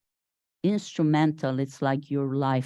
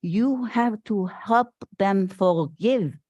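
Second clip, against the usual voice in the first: put-on slow, slightly higher, lightly Viennese-accented doctor; heavy Viennese accent; 4 semitones higher than usual. Retelling Frankl's advice to her, in a light Viennese accent. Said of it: "with his Viennese accent".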